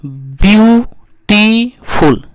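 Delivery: ब्यूटीफुल in three slow, separate beats; split into syllables. Shown as a demonstration. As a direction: draw out and separate ब्यू-टी-फुल in ब्यूटीफुल. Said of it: "तीन गो साइलेबल्स इसमें आ रहा है". (ब्यूटीफुल को तीन हिस्सों में खींचकर बोलना)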